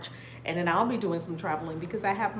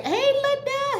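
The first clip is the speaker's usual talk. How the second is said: much higher, louder greeting; high excited hello; 5 dB louder than usual. Real, not acted. Greeting a viewer in the chat, with a high squeal.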